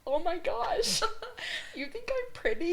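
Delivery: high, breathless flattered squeal with a laugh; giddy disbelief. Retold or imitated. Answering her date's compliment, high and giddy with a laugh. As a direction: squeal it like you can't believe it. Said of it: "And I was like".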